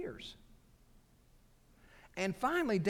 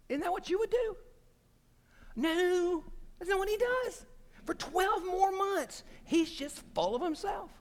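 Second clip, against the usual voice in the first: incredulous high aside. Real, not acted. Preacher to congregation, squeaky disbelieving voice. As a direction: pitch jumps way up in disbelief.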